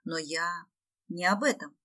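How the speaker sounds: usual speaking voice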